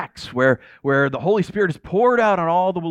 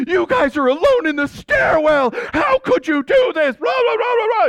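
mock outraged shrieky leader, very high; hammed-up high-pitched shouted telling-off; hysterical scolding; 4 dB louder than usual. Leader catching teens alone in the stairwell, hysterical scolding.

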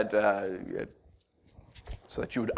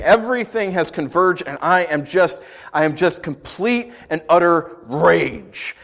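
ordinary talk, nothing marked

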